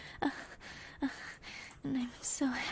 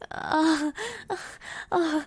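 louder higher rhythmic moans; loud rhythmic moaning; 4 semitones higher, 8 dB louder, slower than usual. Acted. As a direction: moan out loud.

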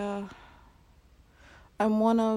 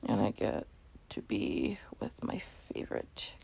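soft, lower loving whisper; tender whisper; 13 semitones lower, 7 dB quieter than usual. Acted. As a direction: breathe it tenderly.

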